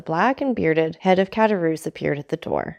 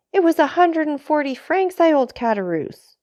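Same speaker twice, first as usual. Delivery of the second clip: cry; 10 semitones higher, 3 dB louder than usual. A narrator's reading. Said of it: "cried Dantès".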